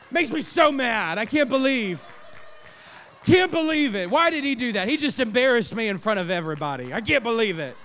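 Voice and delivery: high, loud, hammed-up indignation; mock outraged churchgoer. Pastor imitating an embarrassed church member, hammed-up outrage. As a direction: overplay the outrage, fast and loud. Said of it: "Pick on [name]".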